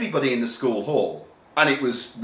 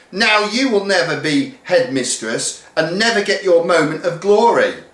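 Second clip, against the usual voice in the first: mocking; 8 semitones higher than usual. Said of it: "mocked Missus Keach".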